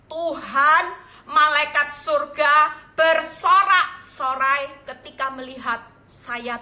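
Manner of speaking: loud, slow, emphatic proclamation; big drawn-out sermon delivery; fervent preaching. Real, not acted. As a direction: preach it out with force. Preaches at full force.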